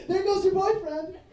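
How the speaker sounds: high mocking girl voice; high, laughing mock of a group of girls teasing; mean girls. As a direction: tease like a catty girl. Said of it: "They go".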